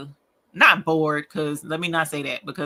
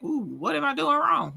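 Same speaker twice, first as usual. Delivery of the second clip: high-pitched mock surprise; concerned reaction; 10 semitones higher than usual. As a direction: act out catching your own mistake. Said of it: "and I'm like".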